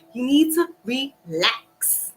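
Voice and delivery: "relax" drawn out slowly, syllable by syllable; slow right down and stretch "relax"; emphatic retold command. Telling a riled-up friend to calm down, slow emphatic "relax".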